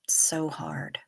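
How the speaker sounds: a real, heartfelt whisper. Real, not acted. Agreeing with the quote about humans in a whisper. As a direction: breathe it out under her breath.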